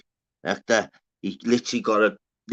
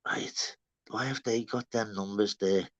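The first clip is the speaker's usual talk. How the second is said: hushed imitated viewer; 5 semitones lower, 10 dB quieter than usual. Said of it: "someone will go".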